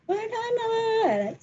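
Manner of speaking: mock teacher yell, high and slow; a teacher calling the class, lightly mocked. Kindergarten teacher mock-calls the children, high and drawn out. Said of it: "kalau bu guru ngajar kan teriak-teriak ya".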